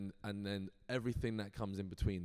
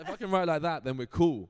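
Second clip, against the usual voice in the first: loud, raised retold excitement; elated relief; 7 semitones higher, 11 dB louder than usual. Writer freed to write his own way, excited. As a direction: burst out with liberated delight. Retells delighted reaction to permission.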